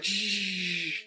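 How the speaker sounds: long hissed takeoff noise; spaceship whoosh. Retold or imitated. Playing X-Wing pilot on the ride, whooshing. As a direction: imitate a fighter jetting off with "shh". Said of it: "I'm in the fucking X-Wing".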